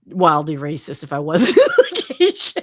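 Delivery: real laugh bursting through the words; laughing while talking. Real, not acted. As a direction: crack up on the last words. Joking about doing accents.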